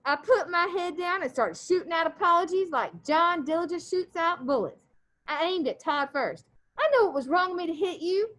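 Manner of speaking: steady read-aloud voice for the class; story read aloud. A narrator's reading. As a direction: read the chapter to the students. Teacher reads novel aloud.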